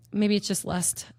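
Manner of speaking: ordinary delivery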